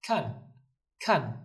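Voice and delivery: kan said with a sharp falling fourth tone; slow falling-tone demo of kan. Shown as a demonstration. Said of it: "kan, fourth tone".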